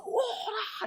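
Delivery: a very high sudden "오라"; sudden cry. Retold or imitated. Pastor voicing Paul's sudden outcry as he writes. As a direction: burst out with "오라". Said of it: "갑자기 확 와서".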